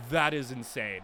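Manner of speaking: ordinary delivery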